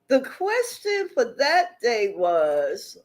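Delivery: usual speaking voice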